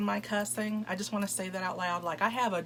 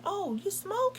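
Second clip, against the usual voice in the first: much higher, a surprised question; surprised mock man; 13 semitones higher than usual. Imitating the date asking about smoking, surprised and higher. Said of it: "he's like".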